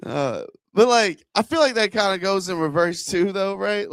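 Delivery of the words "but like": normal talking voice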